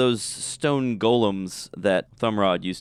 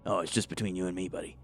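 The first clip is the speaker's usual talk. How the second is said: soft, quick, trailing lower in volume; confidential aside; 3 semitones lower, 10 dB quieter, faster than usual. Acted. Reassuring a buddy who let gossip slip, soft confidential aside. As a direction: keep it between the two of you, hushed and quick.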